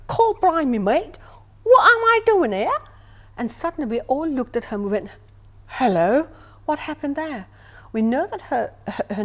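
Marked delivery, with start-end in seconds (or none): 0.1-2.8 loud, high mock Cockney outburst; Cockney accent. Refined lady suddenly speaking in circle, loud imitated Cockney. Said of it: "with a Cockney accent". 5.7-7.5 loud, startled hello, retold; taken aback. Circle looks at Rose after her outburst, retold surprise.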